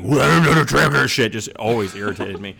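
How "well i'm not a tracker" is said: loud garbled growl of a mock hero voice; Batman. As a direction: growl it out, hammed-up and mangled. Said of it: "was the Batman voice".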